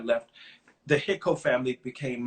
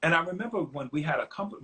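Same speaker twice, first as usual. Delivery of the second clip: usual speaking voice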